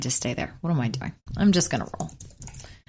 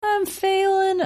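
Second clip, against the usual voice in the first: mock whiny apology; 13 semitones higher, much slower than usual. Apologizing for bad rolls.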